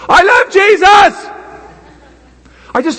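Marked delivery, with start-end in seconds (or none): 0.1-1.3 put-on higher, louder child's exclamation. Grandson [name] flipping back to his loud voice, high and louder. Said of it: "Okay, and you can turn it off now".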